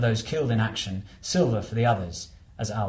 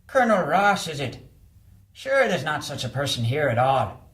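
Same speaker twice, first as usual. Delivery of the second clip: higher, wary Irish porter voice; a suspicious old doorman through the intercom; 4 semitones higher than usual. Acted. Doorman questioning the visitor through the intercom, wary Irish voice.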